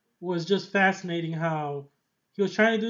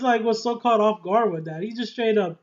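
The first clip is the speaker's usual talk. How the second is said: ordinary talk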